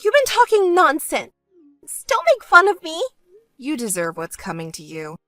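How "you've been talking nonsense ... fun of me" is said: high, loud, angry sister voice; indignant sister. Acted. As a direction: voice an outraged sister, pitched up. Sister snaps back in story dialogue.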